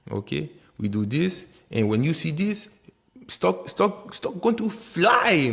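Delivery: hammed-up Haitian accent, climbing high; playful Haitian accent. Trader jokingly predicting a rising stock, exaggerated Haitian accent. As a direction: build to a high, stretched Haitian-accented punchline. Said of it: "you can tell I'm Haitian".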